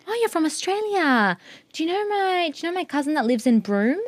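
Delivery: high, lightly teasing imitation of a nosy acquaintance; mock eager stranger. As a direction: lift the voice into mock eager recognition. Mimicking people asking about her country.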